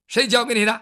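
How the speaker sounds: loud barked demand; demanding shout. Acted. Hero demanding who taught the poison, loud shout. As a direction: hit it hard and loud.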